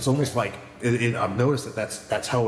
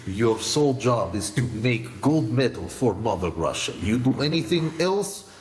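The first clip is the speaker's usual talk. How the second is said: hammed-up Russian accent, stern and dropping to a slow threat; joking Russian accent; mock Russian coach. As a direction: play a Soviet coach barking orders in broken English.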